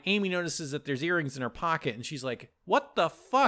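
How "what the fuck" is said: high-pitched indignant disbelief; raised, put-on outrage; mock outrage. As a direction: confront a friend in disbelief. Acted outraged discovery.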